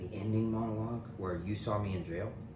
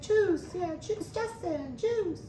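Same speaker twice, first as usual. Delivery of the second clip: much higher mock girl voice, repeating the name; imitating Kinsey; much slower than usual. Kinsey naming the new dealer, high mock voice. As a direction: say the name over and over in a high put-on voice.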